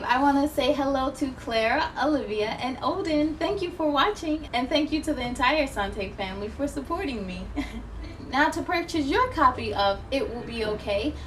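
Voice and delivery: ordinary delivery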